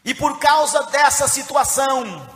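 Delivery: preacher projecting to the congregation; impassioned preaching. Real, not acted. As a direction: carry the Bible story forward forcefully. Preaches at full force.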